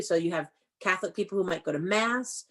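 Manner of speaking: ordinary delivery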